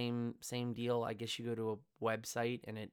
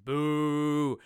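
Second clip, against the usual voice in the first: boo; 3 semitones higher, 10 dB louder than usual. Acted. Booing the actor's name.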